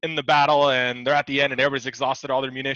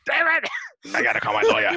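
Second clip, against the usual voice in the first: scratchy-voiced coworker; 13 semitones higher, 3 dB louder than usual. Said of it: "the real scratchy voice".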